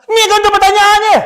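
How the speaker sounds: high, loud demanding bark; mock angry customer. Angry customer at the inquiry counter, mock demand.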